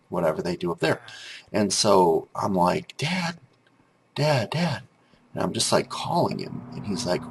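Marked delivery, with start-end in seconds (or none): 3.0-4.8 high-pitched call to dad downstairs. Retold or imitated. Child calling dad from his bedroom, high call. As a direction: call him up the stairs, pitched up.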